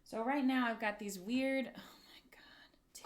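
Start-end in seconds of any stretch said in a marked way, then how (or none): 1.7-3.0 breathless unvoiced whisper; dismayed whisper. Real, not acted. Crafter seeing rusty screws, whispering in horror.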